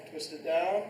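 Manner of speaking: normal talking voice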